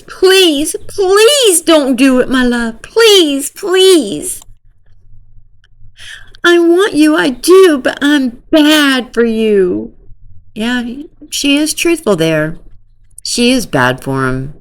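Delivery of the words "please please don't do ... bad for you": higher, slow mock sobbing plea; hammed-up weepy begging; fake crying. Girl begging boyfriend not to do it, mock sobbing. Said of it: "the fake laugh and cry thing".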